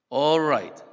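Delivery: loud mock-panted reply; panting assent. A narrator's reading. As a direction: give in under pressure, acted out. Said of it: "panted John".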